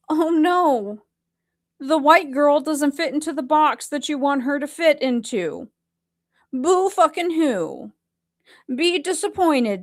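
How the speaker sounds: high-pitched sarcastic fake dismay; mock pity. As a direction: taunt them with put-on sympathy. Mocks critics with fake pity.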